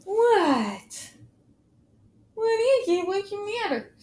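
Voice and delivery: sweet high pet voice asking what's wrong; high cat-talk voice. Real, not acted. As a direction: coo up high to a startled kitten. Talking to her upset cat.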